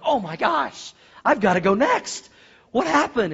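acted dread of being next; panicked younger brother. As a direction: blurt it high and loud. Scared younger brother in joke.

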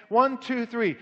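ordinary talk, nothing marked

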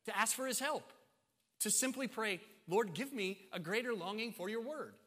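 high, loud sermon delivery; preaching at full force. Real, not acted. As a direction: punch out each command to the congregation.

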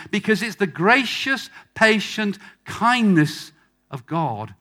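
high, loud preacher's proclamation; impassioned preaching. Real, not acted. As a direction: drive each repeated phrase home forcefully. Preaches at full force.

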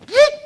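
sudden high, loud whoop; a squeaky whoosh of time flying; whoop sound effect. Pretend. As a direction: zip past with a yelp.

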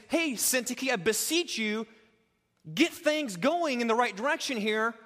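a blunt rebuke, loud; mock scolding. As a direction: snap at her to shape up. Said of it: "What he doesn't say is".

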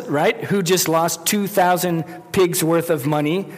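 normal talking voice

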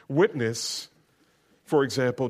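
usual speaking voice

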